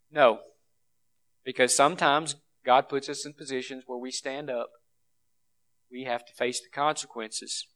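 low, soft, somber preaching; quiet, reverent gravity for the martyred; hushed solemnity. Real, not acted. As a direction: drop to a grave hush, with long pauses. Solemn recalling of murdered believers.

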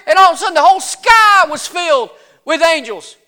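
high, breathless-paced witness account; excited shepherd. Acted. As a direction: pour out the amazing story to a doubter. Shepherd excitedly insists on his story.

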